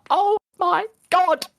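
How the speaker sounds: very high, slow mock wail of awe; overwhelmed fan wail. Fan retelling the moment she hit the note, high drawn-out wail. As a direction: stretch each word into a high, overcome cry.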